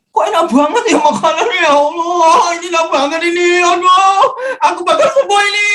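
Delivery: high, wailing cry of gratitude; retold tearful joy. Patient overwhelmed by tasty hospital food, crying with joy. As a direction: re-enact crying out in overwhelmed relief. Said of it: "hari kedua saya nangis itu".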